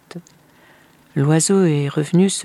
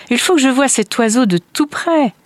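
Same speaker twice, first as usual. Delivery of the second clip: high, louder, excited declaration; eager father; 8 semitones higher, 5 dB louder than usual. Acted. Father must see the bird up close, eager. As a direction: say it bursting with curiosity.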